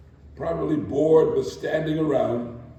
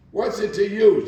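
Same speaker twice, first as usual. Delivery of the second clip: loud, higher, brash comeback; brash retort; 7 semitones higher, 6 dB louder than usual. A narrator's reading. Street-wise woman rebuffing a stranger, brash and loud. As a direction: snap it back, brash and tough. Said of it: "Her voice was brash".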